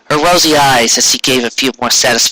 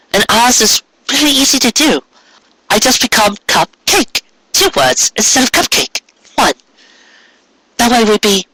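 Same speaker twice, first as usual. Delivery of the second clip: higher mare voice, hopeful; higher voiced wife pitching name change; eager wife voice; 9 semitones higher than usual. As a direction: explain the plan with bright hope.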